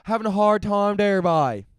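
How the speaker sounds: mock Newfie teasing; hammed-up Newfoundland local; bad Newfie accent. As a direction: put on a broad Newfoundland accent. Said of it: "Newfie accent's hard".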